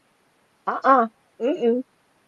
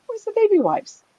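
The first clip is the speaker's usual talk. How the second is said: puzzled mother; 13 semitones higher than usual. Retold or imitated. Mother searching for baby wipes.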